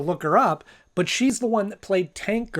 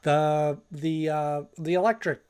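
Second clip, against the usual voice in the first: ordinary delivery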